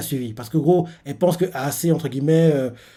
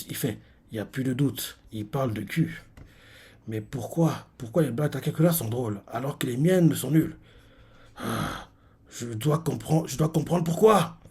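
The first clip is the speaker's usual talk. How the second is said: muttered thoughts; 3 semitones lower, 7 dB quieter than usual. Acted. Voicing jealous classmate's inner thoughts.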